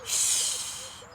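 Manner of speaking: high whispered shh; baby's shush. Acted. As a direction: hush like a tiny baby. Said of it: "said the baby".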